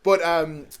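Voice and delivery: ordinary delivery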